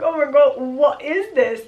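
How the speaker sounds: loud, disbelieving burst; shocked exclamation. Real, not acted. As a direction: react like you can't believe what you see. Her own shocked reaction to the scene.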